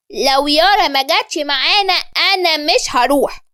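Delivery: put-on high-pitched little-boy voice; stubborn child. A narrator's reading. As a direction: dig in like a small kid. Child stubbornly repeats the refusal.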